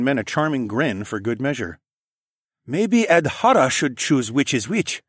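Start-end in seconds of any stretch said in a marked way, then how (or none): 2.7-5.0 put-on higher, lightly accented man; Sun He voice. A narrator's reading. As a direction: give him a polite, formal lilt. Sun He character voice.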